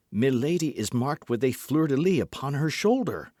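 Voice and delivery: usual speaking voice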